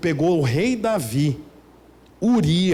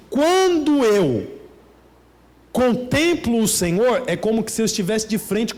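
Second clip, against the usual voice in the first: emotional preaching; 4 semitones higher than usual. Real, not acted.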